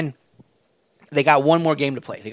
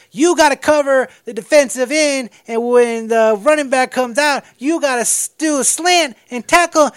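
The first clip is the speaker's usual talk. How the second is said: higher, louder eager coaching voice; mock coach; 7 semitones higher, 4 dB louder than usual. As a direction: rattle off the play like a keen coach. Coach voicing play at whiteboard.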